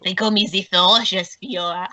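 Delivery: put-on French accent; French-accented wrestler. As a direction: announce your nickname in a French accent. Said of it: "his French accent".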